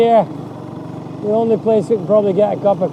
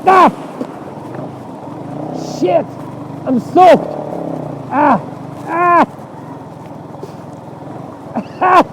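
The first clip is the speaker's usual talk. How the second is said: startled yelps; 6 semitones higher than usual. Real, not acted. Riding through deep water.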